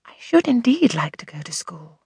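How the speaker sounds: half-voiced musing; wistful whisper. A narrator's reading. Girl musing aloud to herself, wistful whisper. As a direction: think it aloud, softly hopeful. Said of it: "the audible conclusion of my musings".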